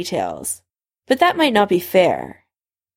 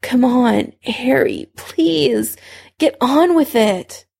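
higher, pleading urging; whining impatience; 5 semitones higher, slower than usual. A narrator's reading. Severus urges Harry to hurry, whining impatiently. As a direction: whine and beg to hurry, pitched up. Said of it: "Severus directed and moaned and whined".

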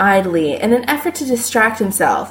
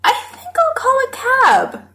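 thoughtful. A narrator's reading. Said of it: "Chris says thoughtfully".

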